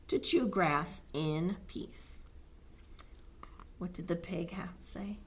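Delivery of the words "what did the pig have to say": soft whispered question. Real, not acted. Reader whispering a question to the children. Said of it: "whispered question to children".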